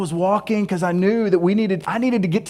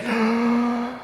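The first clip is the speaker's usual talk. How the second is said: sharp breathy intake of panic; anxious gasp; 4 semitones higher, 6 dB quieter than usual. Retold or imitated. Overwhelmed host gasping in mock anxiety. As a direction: gasp like the worry just hit. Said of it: "the anxiety is just like".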